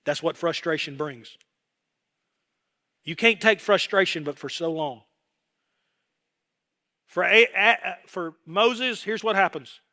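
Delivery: ordinary talk, nothing marked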